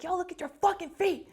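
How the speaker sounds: high, loud imitated drill order; yelling. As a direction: bark it at the whole line of recruits. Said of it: "he comes start yelling at us".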